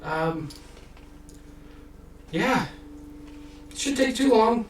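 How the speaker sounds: put-on old halfling telling the party how far the city is, squeaky voice; chatty old halfling. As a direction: pitch way up, rambling kindly.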